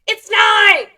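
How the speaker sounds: high, very loud mock shout; angry dad yelling. Dad shouting the answer at his child, angry mock yell.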